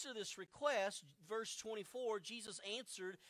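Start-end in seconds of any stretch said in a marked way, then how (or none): none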